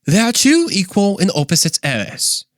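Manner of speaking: high, put-on British accent; C S Lewis impersonation. Shown as a demonstration. Podcaster reads the book as its author, British accent. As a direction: declaim it in an exaggerated British accent. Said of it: "with my best C S Lewis impersonation".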